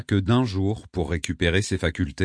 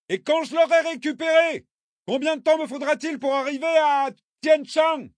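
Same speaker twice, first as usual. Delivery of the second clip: calling out. A narrator's reading. Traveller carried off by current, calling out. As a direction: send each phrase across the river. Calling from drifting kayak to shore.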